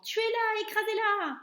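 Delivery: high, loud urgent yell; shouting crowd. A narrator's reading. Kitchen staff chasing the mouse, high loud yell. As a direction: bark the order at the mouse. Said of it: "criaient les gens".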